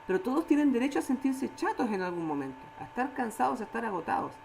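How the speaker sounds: ordinary talk, nothing marked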